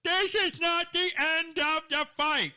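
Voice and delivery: loud, high, slow put-on lawyer voice; creepy voice. Host quoting the disappointed families' lawyer, in a creepy voice. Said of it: "I always try to use a creepy voice".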